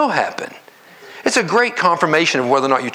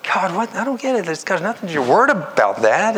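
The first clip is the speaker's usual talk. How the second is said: higher-pitched, baffled questioning; puzzled protest to God; 6 semitones higher than usual. Acted. Believer questioning God over a hard demand, puzzled protest. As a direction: argue it out in confusion, pitch raised. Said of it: "we may have long conversations over it".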